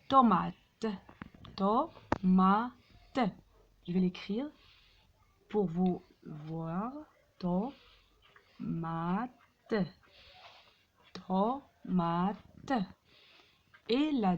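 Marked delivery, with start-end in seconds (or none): 1.6-3.2 "Tomate" split into to, ma, te with pauses; syllable by syllable. Shown as a demonstration. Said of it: "frappez les syllabes". 7.4-9.3 "Tomate" in slow separate beats, to, ma, te; long gaps between the syllables of "Tomate". Shown as a demonstration. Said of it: "frappez les syllabes". 11.3-12.7 "Tomate" broken into to, ma, te; syllable by syllable. Shown as a demonstration. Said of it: "frappez les syllabes".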